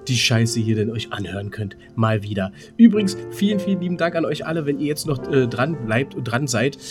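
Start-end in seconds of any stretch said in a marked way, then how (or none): none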